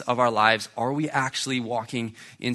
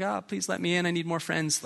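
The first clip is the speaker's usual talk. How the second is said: high, softer mock pleading voice; desperate lonely Jesus; 6 semitones higher, 4 dB quieter than usual. Jesus knocking at our door, begging in a high mock plea. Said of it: "desperate, lonely Jesus who needs more friends".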